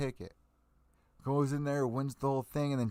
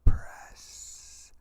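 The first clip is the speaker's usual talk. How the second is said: one word forced out in a much louder voiceless whisper; loud stage whisper; 12 dB louder than usual. Acted. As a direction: spit the word as a pointed whisper. Final jab at media.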